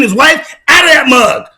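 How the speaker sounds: ordinary talk, nothing marked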